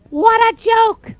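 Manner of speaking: very high, squeaky, slow bird voice; mocking robins. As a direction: tease him from the bush.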